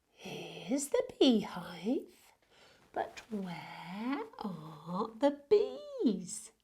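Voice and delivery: slow, soft, mysterious storybook voice; reading to children. Acted. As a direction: draw the little ones in. Reads rhyme to children.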